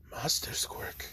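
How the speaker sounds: stunned, unvoiced whisper; shocked whisper. All Might recognizes the quirk.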